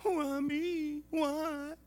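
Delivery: high, whiny mock cry of why; a hammed-up high whimper of why me; Nancy Kerrigan wail. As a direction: parody a victim's wailing. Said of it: "We'd do that Nancy Kerrigan".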